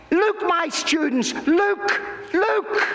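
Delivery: eager cry of discovery building up; excited calling out. Acted. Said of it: "all of a sudden he says".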